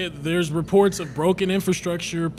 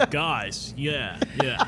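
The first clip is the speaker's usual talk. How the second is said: impression of Tommy; 5 semitones lower, much slower than usual. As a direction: ham up how a coworker sounds. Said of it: "Tommy, he'll talk like this".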